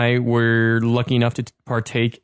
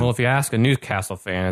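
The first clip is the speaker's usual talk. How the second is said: usual speaking voice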